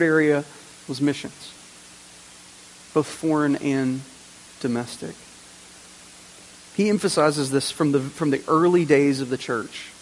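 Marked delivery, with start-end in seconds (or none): none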